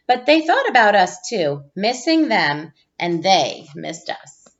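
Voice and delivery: put-on gentle storybook read-aloud for young listeners; reading to children. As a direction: read it like a teacher sharing a picture book with the class. Reads picture book to class.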